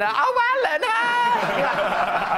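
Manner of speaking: very high, loud mock-delighted greeting; gushing recognition. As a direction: imitate someone gleefully recognising a celebrity. Retold operator recognising him, delighted.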